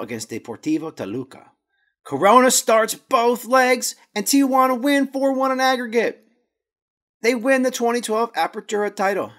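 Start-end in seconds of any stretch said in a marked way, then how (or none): 2.0-9.3 excited. Acted. Team wins the title.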